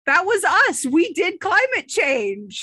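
high, loud, slow gloating; evil villain boast. Acted. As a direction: gloat like a cartoon supervillain. Villains boasting of climate change.

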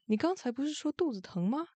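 a touch of angry disbelief; angry question. A narrator's reading. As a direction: question it in high-pitched annoyance. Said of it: "大木桶生气地问".

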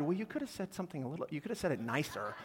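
a gentle wifely correction; wife gently chides him, softly and quickly; soft mock wife. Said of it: "my wife said".

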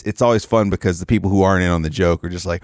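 normal talking voice

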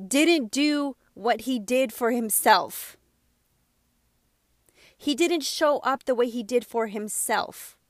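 ordinary talk

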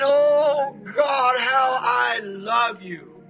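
a mild mock weeping prayer, stretched out; imitating a man crying out to God with tears running; tearful praise. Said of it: "the tears rolling down his cheeks".

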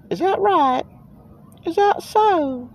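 high, slow mock falsetto. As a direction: lift into a teasing falsetto. Mocking the can's claims.

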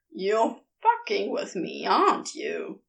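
low, suspicious accusation; accusing hiss. A narrator's reading. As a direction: accuse him through your teeth. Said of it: "he accuses on a hiss".

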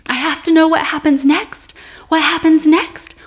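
high, loud, desperate mock bawl; retold bawling. Man on a chair begs for the story, acted loud bawling. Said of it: "he's bawling, and he says".

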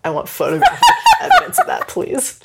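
high laughing words. Real, not acted. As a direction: laugh through the request. Laughing at surfboard idea.

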